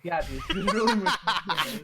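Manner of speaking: loud, high, fast and laughing; cracking up while echoing the sarcasm. Real, not acted. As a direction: laugh out the mocking line. Laughing echo of sarcastic line.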